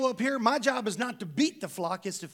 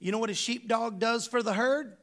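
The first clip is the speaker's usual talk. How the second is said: usual speaking voice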